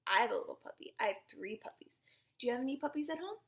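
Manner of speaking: usual speaking voice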